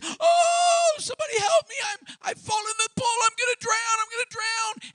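high, shouting, panicked drowning man; non-swimmer yelling from the pool, panicking; panicked cry for help. Retold or imitated. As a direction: scream for help like he's going under. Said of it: "and he panics".

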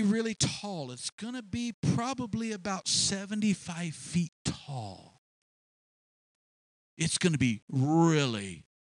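grand, drawn-out proud proclamations; boastful king. Acted. King announcing his golden image, swelling with pride, slow and grand. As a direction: sound like a king who thinks himself a god.

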